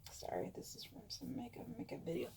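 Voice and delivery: quick whispered aside; whispered apology. Real, not acted. Speaker to viewers, apologizing for the makeup video, hurried whisper. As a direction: whisper a quick sorry off to the side.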